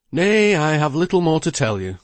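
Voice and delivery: lightly voiced lawyer, a little worried; lawyer Bridgenorth.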